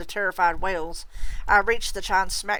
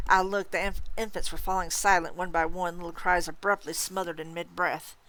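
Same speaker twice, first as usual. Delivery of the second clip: plain reading voice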